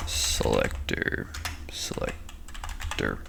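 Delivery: dry mouth, groggy morning voice; croaky, just-woke-up mumble. Real, not acted. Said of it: "I can't talk right now. I just woke up. My mouth is dry".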